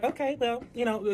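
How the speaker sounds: imitating a breezy, unbothered reply; nonchalant. Other woman brushing off a warning, nonchalant. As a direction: toss it off lightly, mimicking her. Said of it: "just so nonchalant about every motherfucking thing".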